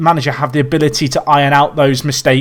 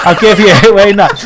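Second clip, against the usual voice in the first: laughing; 2 semitones higher, 8 dB louder than usual. Real, not acted. Conceding the table row, laughing.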